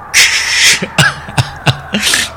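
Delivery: breathy sinister cackle; cold laugh. Acted. Mother cackling coldly at her bound daughter. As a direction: chill them with a laugh.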